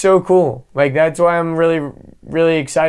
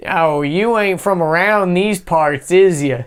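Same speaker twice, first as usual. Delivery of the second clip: slow, higher Southern drawl, mock local; imitating a Southern local, slow and high; Southern accent; 4 semitones higher, slower than usual. Mocking small-town Southerners doubting an outsider, Southern accent. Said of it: "they've got their accent where if you talk like them".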